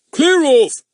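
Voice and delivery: furious bellow, loud and high; angry shout. A narrator's reading. Policeman roaring at the yapping dog, furious. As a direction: bark it out at the dog. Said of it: "he said angrily".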